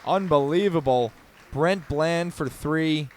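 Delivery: high, loud shouted call; excited play-by-play. Real, not acted. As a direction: yell it as the shot drops. Three-pointer made, big call.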